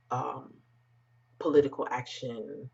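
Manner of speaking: normal talking voice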